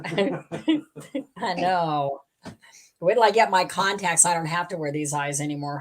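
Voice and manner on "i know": laughing. Real, not acted. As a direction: laugh through the words. Laughs at glasses joke.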